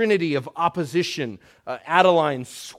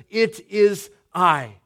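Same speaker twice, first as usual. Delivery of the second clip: slow, louder "It is I" with weight on "I"; emphatic I; 4 semitones higher, 6 dB louder, slower than usual. Shown as a demonstration. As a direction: declare it, landing on "I". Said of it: "it's emphatic".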